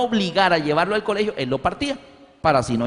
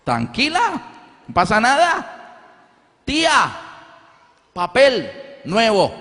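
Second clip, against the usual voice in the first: high, slow childish imitation; carefree child; 6 semitones higher, slower than usual. Boy telling his mother the aunt will pay, high childish voice. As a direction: play the seven-year-old shrugging it off, high and slow.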